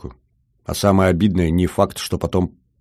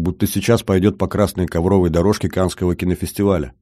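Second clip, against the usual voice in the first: plain reading voice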